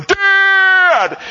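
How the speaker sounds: high loud shout; son calling Dad. Retold or imitated. Son bursts into the garage shouting. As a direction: yell for your dad from the door. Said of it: "Gabriel walks through the door going".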